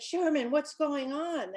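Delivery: a stern father asking what's up; father's startled question. Acted. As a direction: demand an answer, surprised. Father confronts Sherman at party.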